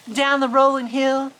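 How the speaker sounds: ordinary delivery, nothing marked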